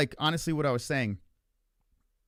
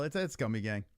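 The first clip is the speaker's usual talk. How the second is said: usual speaking voice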